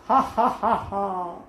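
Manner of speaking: acted ha ha ha laugh; demo laugh. Shown as a demonstration. Teacher acting out a laugh to explain the verb. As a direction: show laughing with a mock ha ha ha.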